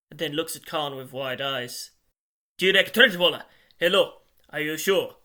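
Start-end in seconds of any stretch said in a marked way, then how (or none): 2.6-5.2 loud, higher, startled phone voice; urgent checking. A narrator's reading. Therese checking the name by phone, loud and urgent. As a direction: burst out with the name, then press.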